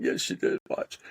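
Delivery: high, breathy retort; mock insistence. Arguing with his wife over a shoplifter, high and insistent. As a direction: insist in a high near-whisper.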